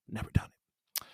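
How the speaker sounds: breathy whisper of dread. Real, not acted. Intern before his first broadcast, whispered dread. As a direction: murmur it to yourself, scared.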